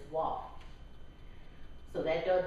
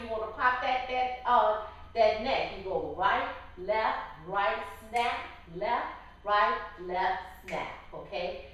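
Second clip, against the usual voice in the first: sassy; 2 semitones higher, 3 dB louder than usual. Shown as a demonstration. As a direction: pop the neck with attitude. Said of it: "sometimes you feel a little sassy".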